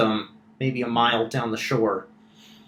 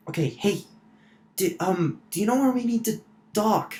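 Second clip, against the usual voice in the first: lightly voiced, higher-pitched character; a hint of an eager messenger, pitched up; Alana; 8 semitones higher than usual. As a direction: wake the party with an urgent question. Game master plays Alana waking party.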